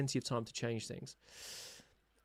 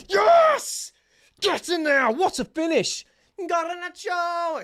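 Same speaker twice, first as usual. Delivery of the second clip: ecstatic shouts, much higher and louder, the name stretched out long; goal celebration; 11 dB louder, much slower than usual. Real, not acted. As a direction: roar it like your team just scored. Fan celebrating his team's goal.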